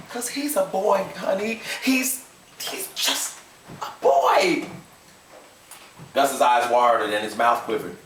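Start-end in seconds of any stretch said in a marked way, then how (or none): 0.1-4.7 pleading wife. A narrator's reading. Emma Jean softly pleading.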